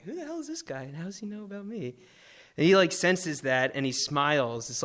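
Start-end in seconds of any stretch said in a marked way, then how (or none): 0.0-1.9 hushed, high-pitched disbelief; stunned aside to yourself, very soft; dumbfounded. Retold or imitated. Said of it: "I look up at him dumbfounded".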